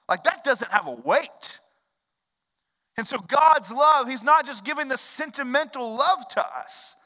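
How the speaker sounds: preacher mocking sentimental love, animated; animated preaching. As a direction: get lively, mock the sappy card.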